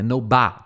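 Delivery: "No ba" with the "ba" punched; stress on BA. Shown as a demonstration. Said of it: "a sílaba forte no ba". ("No ba", com o "ba" forte)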